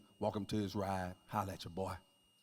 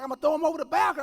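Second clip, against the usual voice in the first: high, loud and fast threat; 8 dB louder, much faster than usual. Retold or imitated. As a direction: fire off the threat. Wife threatening through door.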